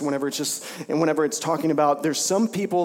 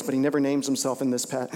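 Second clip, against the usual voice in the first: ordinary talk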